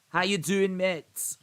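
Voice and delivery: attempted Scottish accent, pitched high; Ali McCoist. Streamer imitating Ali's recorded message to Bellingham, attempted Scottish accent. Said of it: "I can't do the Scottish accent".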